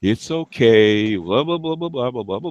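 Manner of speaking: very slow, drawn-out comforting voice; mock soothing. Mocking the kind who pats your hand, slow soothing. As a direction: parody the hand-patting comforter. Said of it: "pat your hand, okay? Like, rub you".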